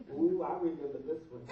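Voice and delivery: giggling through the words; laughing. Real, not acted. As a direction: break up laughing mid-word. Cracks up recalling a text.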